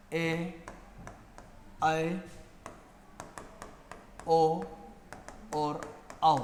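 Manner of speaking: each vowel said slowly and distinctly; vowel recitation. Shown as a demonstration. Teacher reciting vowels one at a time to the class. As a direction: sound out every Hindi vowel clearly, one by one. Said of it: "जैसे पहला है अ".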